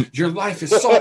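loud, high burst through laughter; laughing. Real, not acted. Teasing friends about having no taste, laughing loudly. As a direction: crack up while talking.

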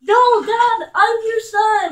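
loud, high, drawn-out plea; shouted plea. Pretend. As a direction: yell to make Dad stop. Pleads with attacking dad in game.

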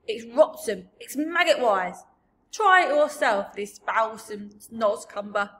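loud, emphatic giant revulsion; big giant railing at foul food; disgusted giant. Acted. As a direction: spit out each insult loudly. BFG rails at foul food.

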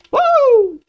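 long high-pitched "woo"; high whoop. Real, not acted. Whoop while playing.

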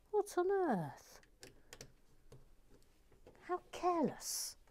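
high, swooping exclamation; soft, high-pitched exasperation; mock dismay. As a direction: scold yourself in a soft high voice. Scolding self for mistakes.

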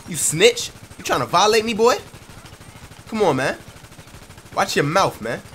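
loud angry threats; angry yell. Acted. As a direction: yell at the snitch, furious. Yelling at a man taking pictures.

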